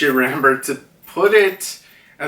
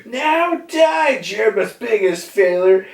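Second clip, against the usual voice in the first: villain's gloating cry; 8 semitones higher than usual. Retold or imitated. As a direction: gloat as the arrow is drawn. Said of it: "Perospero being like".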